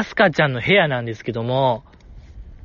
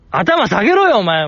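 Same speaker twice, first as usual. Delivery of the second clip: loud, high-pitched pretend scolding; mock rough heckling; 5 semitones higher, 4 dB louder than usual. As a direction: yell at the screen in playful outrage. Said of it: "かなり語気荒めに".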